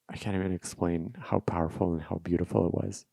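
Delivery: normal talking voice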